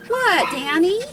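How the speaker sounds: high pet voice; pet talk. Real, not acted. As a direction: ask the dog sweetly. Owner coos at dog.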